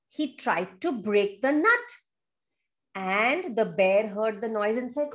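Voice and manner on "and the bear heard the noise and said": ordinary delivery, nothing marked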